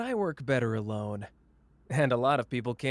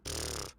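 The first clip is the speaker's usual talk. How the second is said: dismissive scoff; 9 semitones lower, 9 dB quieter than usual. Acted. Scoffing at partner.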